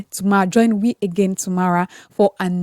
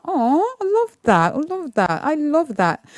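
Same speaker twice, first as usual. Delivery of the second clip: delighted; 6 semitones higher than usual. Real, not acted. Host delighted by listener comment.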